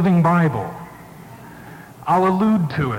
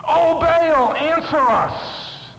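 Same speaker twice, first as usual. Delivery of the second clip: frantic cry; 10 semitones higher, much slower than usual. Retold or imitated. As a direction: cry out to a silent god, stretched slow. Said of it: "screaming and calling upon their God".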